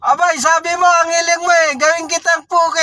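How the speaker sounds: genie voice. Retold or imitated. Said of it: "Sabi ni Jenny".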